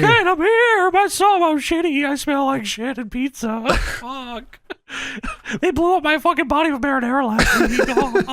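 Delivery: very high mock wail; hammed-up high-pitched whining, cracking up; Paul Bearer. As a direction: squeal it like a comic captive. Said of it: "and Paul Bearer says".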